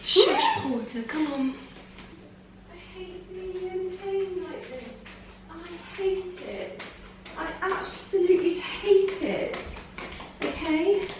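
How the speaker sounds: high, soft, pained soothing; pained comforting. Real, not acted. Owner comforting her distressed cat, high and pained. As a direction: comfort her through it, hurting too.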